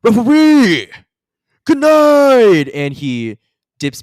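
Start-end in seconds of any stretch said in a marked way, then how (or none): 0.0-2.7 very high, loud farewell shout; rock star sign-off. Acted. Bard leaving the stage, loud high goodnight. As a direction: yell goodnight to the whole hall. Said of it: "he goes".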